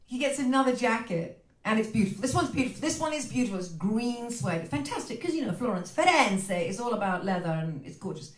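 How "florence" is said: usual speaking voice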